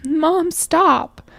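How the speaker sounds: put-on high-pitched pleading child; upset kid. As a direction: imitate a kid begging mom to quit. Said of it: "getting more and more upset".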